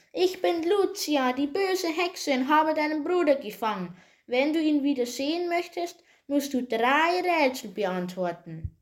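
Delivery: high, shrill wicked-witch voice; Lucia, shrill witch. A narrator's reading. Witch challenges Peter with three riddles, shrill high voice. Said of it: "hörten sie eine schrille Stimme".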